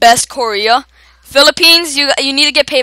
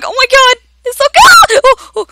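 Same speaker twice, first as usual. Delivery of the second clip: much higher, louder startled squeal; high-pitched scream; 11 dB louder than usual. Real, not acted. Gamer startled by a big eat in the game, high squealing scream. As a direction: squeal in sudden surprise. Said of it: "I screamed like a girl".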